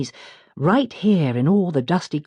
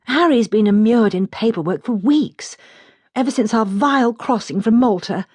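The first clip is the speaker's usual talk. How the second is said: complaining; 7 semitones higher, 4 dB louder than usual. A narrator's reading. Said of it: "complains Helen".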